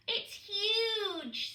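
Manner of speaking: high, loud gasp of wonder; awed little penguin. A narrator's reading. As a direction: squeal at the giant python. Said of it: "said Poots".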